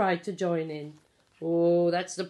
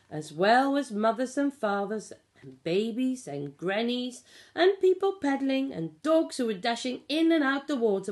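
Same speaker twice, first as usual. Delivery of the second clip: usual speaking voice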